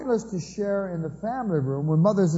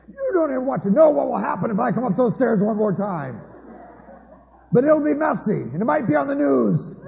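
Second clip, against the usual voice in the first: loud, higher mock threat hollered up the stairs; hammed-up parent yelling up the stairs from below; hollering threats; 7 semitones higher, 4 dB louder than usual. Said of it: "hollering up threats".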